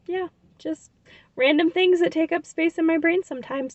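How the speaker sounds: high, bright sign-off voice; chirpy closing line to viewers; vlog outro. Acted. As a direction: wrap it up like the show host. Vlog outro title read.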